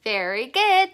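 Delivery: ordinary talk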